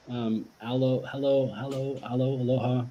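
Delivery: aloe, hello, aloha said alike; sound-alike words. Shown as a demonstration. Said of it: "They all sound like this plant".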